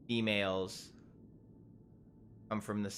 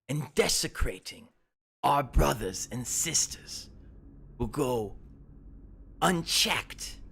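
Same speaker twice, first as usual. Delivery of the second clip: threatening warlord speech; 6 semitones higher, 5 dB louder than usual. Acted. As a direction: menace them, then explode at the end. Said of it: "he goes".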